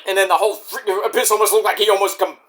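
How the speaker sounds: normal talking voice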